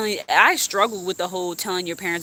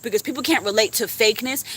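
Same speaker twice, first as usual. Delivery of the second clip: ordinary talk, nothing marked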